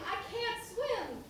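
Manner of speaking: high, slow panicked cry of "swim"; staged drowning cry. Acted. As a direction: wail it like a drowning man. Staged drowning for paying customer.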